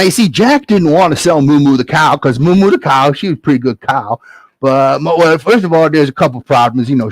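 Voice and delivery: usual speaking voice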